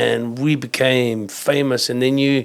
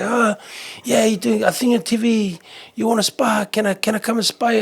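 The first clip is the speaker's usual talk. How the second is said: higher, cocky challenge to spar; lightly voiced cocky local; teasing islander; 6 semitones higher than usual. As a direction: needle the famous fighter, half joking. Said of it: "give you a little jab in the stomach and say".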